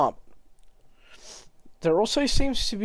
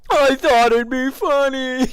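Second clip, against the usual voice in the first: high, sheepish little voice; squeaky excuse; 8 semitones higher, 4 dB louder than usual. Acted. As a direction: whine out the excuse.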